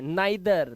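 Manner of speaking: neither said "nigh-ther"; eye sound. Shown as a demonstration. Teacher showing learners neither, eye sound. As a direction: give neither a clear "nigh". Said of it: "आई की आवाज आ रही है".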